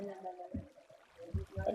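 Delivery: slow, voiceless duck-like croak; lost voice, honking breathy croak. Real, not acted. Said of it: "j'ai une voix de canard". (voix de canard enrouée)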